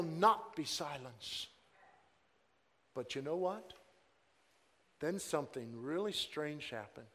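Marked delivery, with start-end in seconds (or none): none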